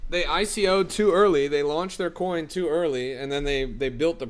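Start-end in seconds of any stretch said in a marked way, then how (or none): 0.1-1.5 emphatic repeat. Real, not acted. Repeats point louder after pause.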